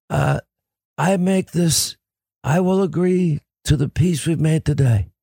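Godfather voice, lightly done; muffled, measured mob boss; Marlon Brando impression. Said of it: "you like my Marlon Brando".

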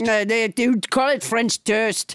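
hammed-up French accent, explaining; French Trump. French Trump explains a translation to the host, French accent. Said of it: "your extremely realistic accent".

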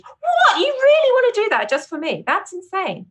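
high, astonished squeal of thanks; overwhelmed by a fan page made for her; stunned fan-page gratitude; gushing disbelief. Retold or imitated.

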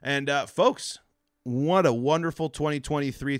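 normal talking voice

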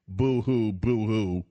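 mocking boo-hoo whine; sarcastic pretend sobbing; fake crying. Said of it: "And the commies tell us".

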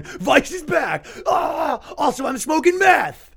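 high, slow, loud triumphant shout; Vice heel turn. Pretend. Announcing the Vice comeback, high and shouting. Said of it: "the Vice heel turn".

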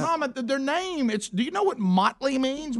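high, pleading disbelief; exasperated question. Retold or imitated. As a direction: press her, comically exasperated. Son questions mother, exasperated.

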